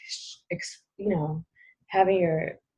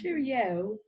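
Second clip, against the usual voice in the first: mock British accent; 5 semitones higher, 3 dB quieter than usual. Joins in the British accent bit.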